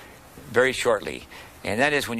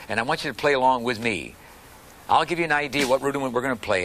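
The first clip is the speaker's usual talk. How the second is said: ordinary talk, nothing marked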